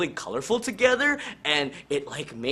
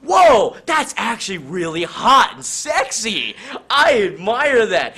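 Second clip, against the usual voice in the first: sudden admiration; 6 semitones higher, 7 dB louder than usual. Acted. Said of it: "And Jonesy's like".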